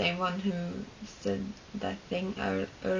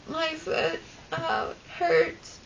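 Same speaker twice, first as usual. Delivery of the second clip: imitating a hurt kid, much higher and slow; pained whine; 8 semitones higher, slower than usual. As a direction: stretch it out like a child in pain. Injured boy complaining.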